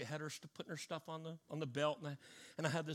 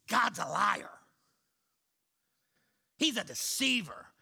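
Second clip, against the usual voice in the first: louder, raised retelling of her shouting; retold yelling; 2 semitones higher, 6 dB louder than usual. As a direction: throw the accusation back at him, loud. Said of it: "And she's yelling at me".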